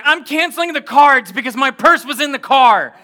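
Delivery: high, loud frantic announcement; hysterical wife. Retold or imitated. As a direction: imitate a panicked wife at full volume. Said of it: "You're hysterical right now".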